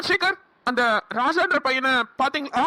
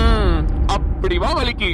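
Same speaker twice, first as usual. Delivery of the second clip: gloating; 4 semitones lower, 4 dB louder, much slower than usual. Acted. Villain gloats as she gives in.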